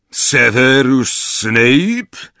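slow, loud, high challenging call; a spell asking a name, drawn out; Moody's voice. A narrator's reading. Moody's recorded voice challenges the intruder, slow and loud. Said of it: "głos Moody'ego".

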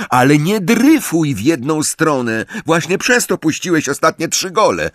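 high, insistent lecture; scolding instructions. A narrator's reading. Angelina correcting Ron's keeping, scolding in a high voice.

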